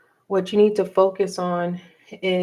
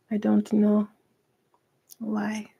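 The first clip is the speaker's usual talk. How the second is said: real tears catching the voice, trailing into a whisper; choked up, the last word barely breathed; 2 semitones higher, 3 dB quieter, slower than usual. Real, not acted. Reader tells her Virgos she's unexpectedly moved, choked up. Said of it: "Why am I getting choked up?".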